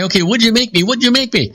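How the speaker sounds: a rabbi who can't wait to see his gift; excited. Retold or imitated. Rabbi eagerly asking the leather maker, excited. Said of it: "he's all excited".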